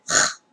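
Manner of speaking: "R" as a voiceless puff of air; English H sound. Shown as a demonstration. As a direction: breathe out the "R" alone. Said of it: "mas eu escuto". ("R" só soprado, som de H)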